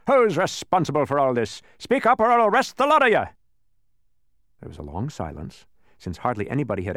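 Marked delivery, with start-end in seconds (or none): 0.1-3.3 loud, rising police bellow; threatening demand. A narrator's reading. Policeman threatening to arrest the whole crowd, loud demand. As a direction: demand a culprit, building to a high shout.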